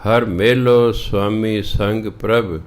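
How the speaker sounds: slow, measured Gurbani reading; scripture recitation. Acted. Teacher reading the shabad to learners, slow recitation. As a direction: recite each line slowly, drawing out the words.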